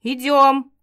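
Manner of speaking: loud commanding call. Acted. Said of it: "повысил голос Грей".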